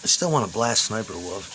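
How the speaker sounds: ordinary talk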